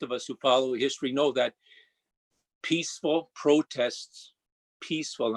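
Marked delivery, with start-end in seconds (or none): 2.6-5.4 peaceful drawn out slowly and firmly, repeated; stress on peaceful. Real, not acted. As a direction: lean hard on peaceful, say it slowly. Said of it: "I stress the word peaceful".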